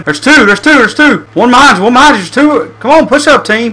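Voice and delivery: high, excited battle callouts; urgent shouting. Real, not acted. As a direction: yell warnings to the squad. Enemies spotted, urging team.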